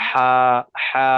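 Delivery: isolated teacher's demo of a long throaty হা; big ha. Shown as a demonstration. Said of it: "গালটা একটু বেশি করে ফাঁক করে".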